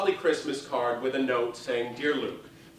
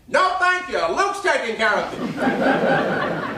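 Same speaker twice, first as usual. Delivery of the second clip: much higher, much louder brassy Broadway belt; 10 semitones higher, 8 dB louder, much slower than usual. Retold or imitated. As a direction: belt it to the second balcony. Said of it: "in that booming voice".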